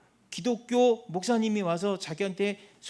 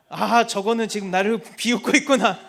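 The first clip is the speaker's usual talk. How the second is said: amused realization; 9 dB louder than usual. Retold or imitated. Retells noticing the grandmother mocking.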